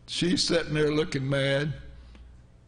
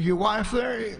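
higher, raised voice across the room; called-out question; 6 semitones higher, slower than usual. Retold or imitated. Preacher calling to the drunk man, raised voice. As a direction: call it out firmly to him.

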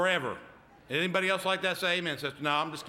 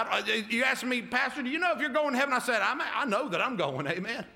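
preaching; 5 semitones higher than usual. Acted. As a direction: preach it out to the congregation. Pastor preaching at full force.

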